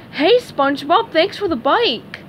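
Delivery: high, singsong lady fish voice; high-pitched cheery thanks; grateful fish character. Acted. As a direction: call out thanks in a high put-on voice. Fish in dress calls out.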